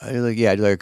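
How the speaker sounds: ordinary talk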